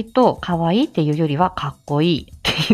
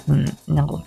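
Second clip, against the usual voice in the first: ordinary delivery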